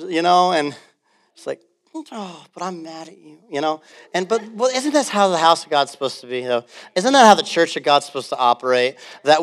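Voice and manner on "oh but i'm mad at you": much softer, mock sulking voice. Pastor imitates an offended churchgoer, very soft. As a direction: sulk it quietly under your breath.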